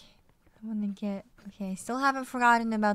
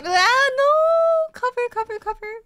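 loud, much higher panicked yelling; panicked shout; 11 dB louder than usual. Real, not acted. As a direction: yell for cover under fire. Ambushed mid-fight, calls for cover.

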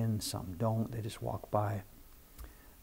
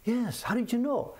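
much higher surprised reply; mock amazed tourist; 10 semitones higher than usual. Singapore couple answering the shop owner, high surprised. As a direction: play the tourist's delighted surprise. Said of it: "you're from Singapore, right".

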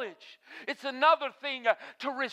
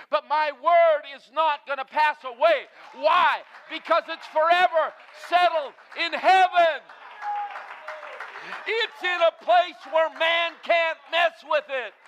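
building from firm to a full shout, higher and louder; rising preaching climax; 4 semitones higher, 4 dB louder than usual. Real, not acted. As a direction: drive it up to a ringing shout.

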